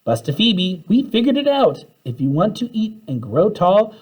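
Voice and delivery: calling out. A narrator's reading. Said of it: "called Tim".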